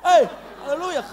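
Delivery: high, loud mock pulpit call; hammed-up shouted greeting; shouting preacher. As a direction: holler it like an overexcited preacher. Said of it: "Lu kenapa sih kalau khotbah teriak?".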